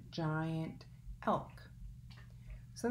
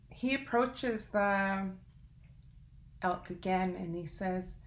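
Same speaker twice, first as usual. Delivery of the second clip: plain reading voice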